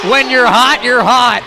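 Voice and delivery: excited hype call. Real, not acted. Hype call after a made shot.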